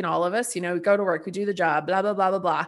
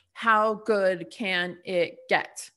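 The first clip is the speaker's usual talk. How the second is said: drawn-out slogan; much slower than usual. Real, not acted. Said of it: "repeated slogan, drawn out".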